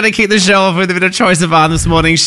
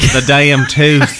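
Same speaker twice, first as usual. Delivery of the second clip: slow, stretched Southern imitation; mock Southern accent; much slower than usual. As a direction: drag it out in a teasing Southern drawl. Mimics co-host's Southern drawl.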